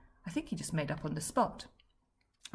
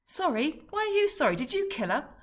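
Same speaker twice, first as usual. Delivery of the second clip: high, louder, incredulous outburst; shocked disbelief; 10 semitones higher, 6 dB louder than usual. Acted. Fred accusing his grieving dad, high shocked outburst. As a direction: fling the question back in disbelief.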